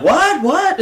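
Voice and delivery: high, loud confused "What?"; confused old man. Retold or imitated. Old guy outside baffled by the hypnotist show.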